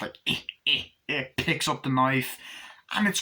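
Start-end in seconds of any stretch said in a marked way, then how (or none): 0.3-1.2 imitated little doll cackle; short, voiceless creaky chuckles; Chucky's laugh. Film fan imitating the killer doll's laugh, creepy chuckle. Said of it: "You hear Chucky's laugh".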